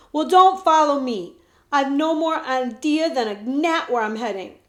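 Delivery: put-on annoyed boy shouting back; exasperated shout. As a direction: push him away with your voice. Jemmy argues with prince.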